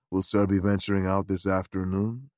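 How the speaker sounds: a low, deferential gentleman's gentleman; valet Beauregard. Acted.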